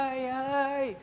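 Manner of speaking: high strained "ay", imitating the patient; mock groaning wail.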